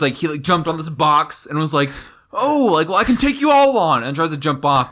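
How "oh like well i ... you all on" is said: high, loud bragging challenge; cocky boast. Retold or imitated. Prince on a box challenging everyone, cocky boast. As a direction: crow it at a raised pitch, louder.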